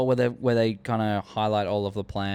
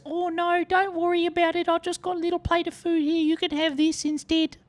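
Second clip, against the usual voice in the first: put-on high sweet girl voice, much higher; Omega. Omega offering the dog her food, high sweet voice. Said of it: "And Omega goes".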